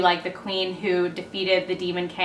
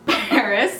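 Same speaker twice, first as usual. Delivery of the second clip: normal talking voice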